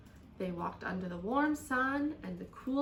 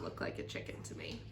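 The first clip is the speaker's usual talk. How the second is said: whispering; 9 dB quieter than usual. Real, not acted. Teacher's doubtful aside.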